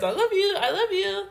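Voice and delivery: high, eager and laughing; high gushing "I love you". Retold or imitated. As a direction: pour it out to a friend. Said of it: "I will tell all my friends".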